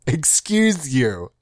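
slow, drawn-out put-down; sassy retort. Acted. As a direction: let it land slowly, with sass.

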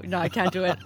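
ordinary talk, nothing marked

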